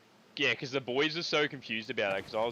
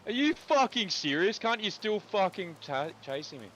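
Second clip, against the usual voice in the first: being chased; 9 semitones higher, 4 dB louder than usual. Real, not acted. Enemy keeps chasing him in game.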